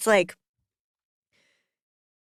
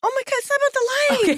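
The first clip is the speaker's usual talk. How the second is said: high, fast laughing-crying protest; teary protest; 12 semitones higher, much faster than usual. Real, not acted. Correcting her cohost, high and fast through tears. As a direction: blurt it out through real tears. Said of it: "I, I, I'm crying".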